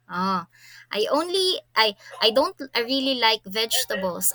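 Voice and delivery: usual speaking voice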